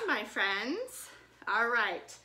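ordinary talk

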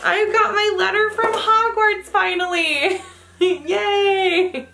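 high, laughing excitement; overjoyed, laughing as she reads; thrilled. Real, not acted. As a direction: gush over the letter. Finds Hogwarts letter.

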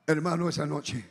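ordinary talk, nothing marked

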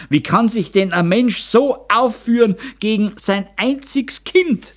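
high-pitched outrage, climbing at the end; screeching protest. A narrator's reading. Mother defends her only child from her husband, screeching. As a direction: protest shrilly without a full scream. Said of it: "kreischte die Meisterin".

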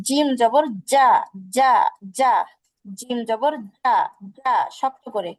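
যা said firm and hard, slowly; firm Arabic jim, slow demo. Shown as a demonstration. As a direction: press যা out strongly.

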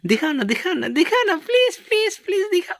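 very high, pleading squeal; playful begging. Pretend. Vlogger coaxes Mala to show the face, playful high-pitched begging. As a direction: beg in a tiny high voice.

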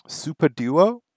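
normal talking voice

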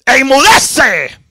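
loud, high order, drawn out; preacher voicing a mighty command; commanding the sea. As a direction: bellow a rebuke at the storm. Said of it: "Y le dijo al mar".

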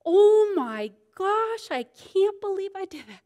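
high, loud, drawn-out oh my gosh; dismayed realization. Retold or imitated. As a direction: catch yourself in the act, mortified. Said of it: "And I go".

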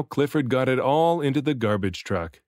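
ordinary delivery

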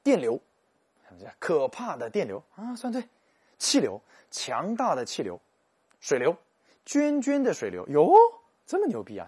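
high, loud exaggerated teacher voice; mock teacher. Mimicking the teacher grading the pupils' fill-in answers, high and hammed-up. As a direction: ham up the schoolteacher's praise. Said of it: "老师说".

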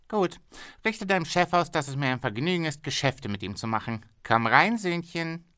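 a pushy boss bargaining hard; haggling factory owner. Acted. Owner pleased, sending message.

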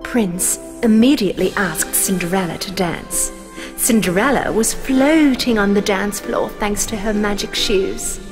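plain reading voice

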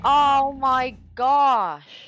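high, very drawn-out exclamation; exasperated groan. Real, not acted. Player reacting to the game, long exasperated exclamation. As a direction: stretch every word out in exasperation.

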